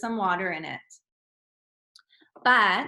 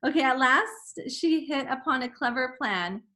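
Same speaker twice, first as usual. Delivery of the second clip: normal talking voice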